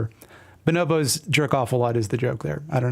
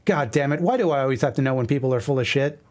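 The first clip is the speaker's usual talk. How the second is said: ordinary talk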